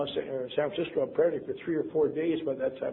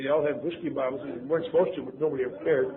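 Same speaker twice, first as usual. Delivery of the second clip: normal talking voice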